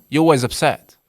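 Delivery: loud reproach, hit always; blaming husband. Retold or imitated. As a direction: scold her for always being upset. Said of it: "You know".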